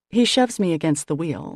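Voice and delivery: ordinary delivery, nothing marked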